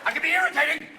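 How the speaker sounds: hammed-up, high-pitched menace; mock hijacker. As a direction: shout demands like a puny hijacker. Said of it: "Are they afraid you're gonna go".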